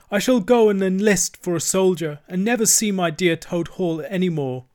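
high, loud, self-pitying lament; acted tearful wail. A narrator's reading. Toad mourns lost Toad Hall, acted tearful wail. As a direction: lament theatrically, as if crying. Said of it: "crying into the sofa cushions".

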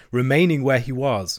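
plain reading voice

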